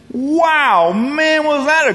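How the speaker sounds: gleeful admiration; impressed praise. Retold or imitated. Master praising the steward's great idea, high and loud.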